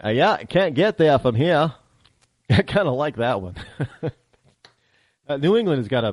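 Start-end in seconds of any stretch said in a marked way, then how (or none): 0.1-1.7 slow, higher Maine accent; r-less Down East Maine accent. Shown as a demonstration. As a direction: drawl it like an old-time Mainer. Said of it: "I do happen to like the Maine accent".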